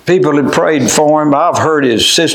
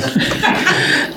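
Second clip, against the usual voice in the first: a soft, airy burst of delighted laughter; breathy laugh; 5 dB quieter than usual. Real, not acted. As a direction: laugh quietly with joy at the memory. Laugh after the quote.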